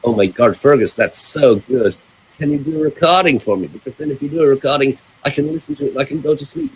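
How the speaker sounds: imitated Los Angeles girlfriend, delighted; lightly mocked Los Angeles speech; Los Angeles accent. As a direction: put on a Los Angeles accent, pleading sweetly. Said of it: "Los Angeles accent".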